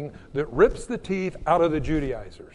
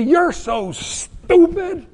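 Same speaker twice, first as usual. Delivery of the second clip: slow, loud, higher mock scorn; exaggerated contemptuous jab; mock insult; 9 semitones higher, 8 dB louder, much slower than usual. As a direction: sneer it out as the wrong way to talk. Harsh way to talk to someone.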